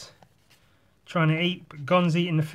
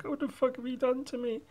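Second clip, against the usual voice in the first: whiny shark; 10 semitones higher, 8 dB quieter than usual. Retold or imitated. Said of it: "And the shark's like".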